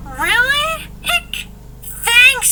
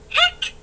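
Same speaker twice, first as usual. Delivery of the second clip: hiccup; 14 semitones higher than usual. Acted. [name] hiccuping.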